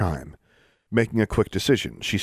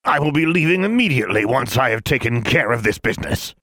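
higher, put-on official voice; posing as a man; 5 semitones higher, 4 dB louder than usual. A narrator's reading. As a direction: announce it importantly in a put-on voice. Disguised Alexandra insists as Mister Brown.

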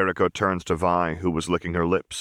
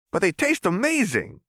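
put-on much higher, excited boy comeback; retorting kid. A narrator's reading. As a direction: fire back with glee. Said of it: "Clagger retorted".

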